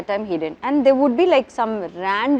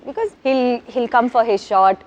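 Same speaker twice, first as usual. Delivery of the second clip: ordinary talk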